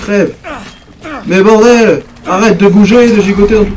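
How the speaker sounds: yelling at the monster to stop moving; furious yell. Real, not acted. Player stabbing a wriggling monster, furious yell.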